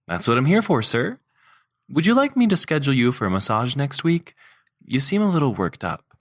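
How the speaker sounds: eager female assistant, attentive and polite; Donna's voice. A narrator's reading. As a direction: raise the pitch for the helpful secretary. Assistant Donna speaks to boss.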